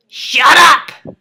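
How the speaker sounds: sudden loud snapping yell. Acted. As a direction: bark shut up at the class. Teacher suddenly yells.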